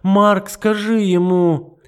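high, loud desperate begging; a frantic plea; pleading. Acted. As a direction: beg him for time. Matilda pleads.

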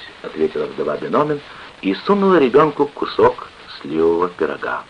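plain reading voice

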